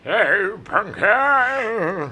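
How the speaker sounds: drawn-out, very slow greeting; slow character voice. Retold or imitated. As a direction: stretch it out like an old doctor. Quoting George Gaines character.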